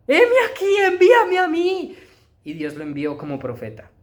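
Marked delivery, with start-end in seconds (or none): 0.1-2.0 eager volunteering. Acted. Isaiah answering God's call, high and loud with zeal. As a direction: answer God with everything you have.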